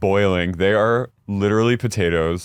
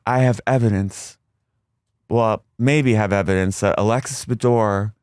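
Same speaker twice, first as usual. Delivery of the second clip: 1 mock Shannon. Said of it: "Shannon goes".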